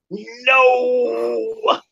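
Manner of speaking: high, long-drawn mock wail of refusal; hammed-up drunken "no", stretched out; drunk friend. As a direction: imitate a wasted pal howling his defiance. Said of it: "you would have thought he drank ten gallons of fucking vodka".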